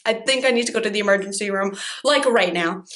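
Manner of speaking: fast, urgent, tumbling out; rushed panic. Retold or imitated. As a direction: rush it in a panic. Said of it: "how overdramatic I was".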